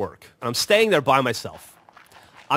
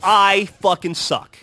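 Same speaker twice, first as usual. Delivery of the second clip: loud, slow, higher-pitched self-reproach; self-mocking lament; 5 semitones higher, 7 dB louder, slower than usual. Real, not acted. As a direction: declare your own failure, drawing it out. Owns up after friends' teasing.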